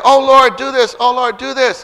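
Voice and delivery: high, loud repeated plea; urgent pleading prayer. Acted. Believer praying without faith, loud urgent plea. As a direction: beg the Lord insistently. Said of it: "You pray".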